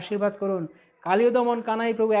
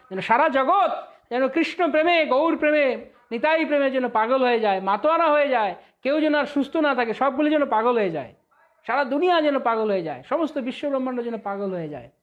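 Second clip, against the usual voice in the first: raised, loud impassioned preaching; fervent preaching; 4 semitones higher, 4 dB louder than usual. Acted. Preacher praying before devotees, loud and impassioned. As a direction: pour out the prayer with rising fervour. Fervent prayer that all go mad.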